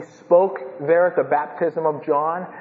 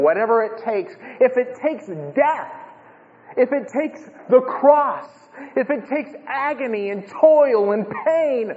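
impassioned preaching; 6 semitones higher, 4 dB louder than usual. Real, not acted. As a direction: build to a loud cry to Jesus. Preaches at full force.

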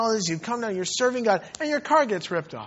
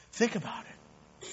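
hushed urging; 2 semitones higher, 9 dB quieter, slower than usual. Real, not acted. Preacher urging congregation to reflect.

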